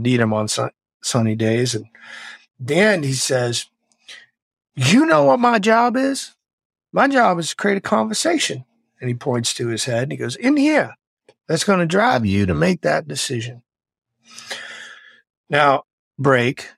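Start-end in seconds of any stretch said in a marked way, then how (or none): 4.7-8.7 imitating the SEAL instructor's voice; gruff old instructor. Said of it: "And he talked like this". 10.3-13.6 imitating the SEAL instructor's voice; gruff old instructor. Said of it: "And he talked like this".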